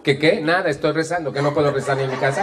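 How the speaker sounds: high, fast mock-defensive retort; hammed-up indignant muttered complaint; touchy grumbling. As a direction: snap back as the wronged martyr. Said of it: "el tono de voz es más o menos así".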